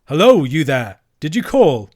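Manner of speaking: loud call to someone far off; shouting. A narrator's reading. Andrew hailing the yellers in the dark, shouting. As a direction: hail them loudly. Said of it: "Andrew shouted".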